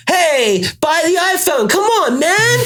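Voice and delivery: high, pleading mock hard sell; yelling salesman. Pretend Apple store seller pesters a shopper, yelling. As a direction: holler like a desperate street vendor. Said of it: "if you have to yell".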